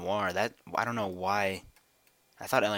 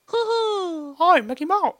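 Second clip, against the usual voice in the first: very high, loud squeaky cartoon voice; Mickey Mouse; 10 dB louder, much slower than usual. Shown as a demonstration. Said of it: "do the Mickey Mouse voice".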